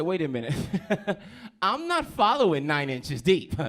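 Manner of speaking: loud, slow, disbelieving; incredulous retort. Retold or imitated. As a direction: spell it out slowly, baffled and amused. Comedian reacts to the handle.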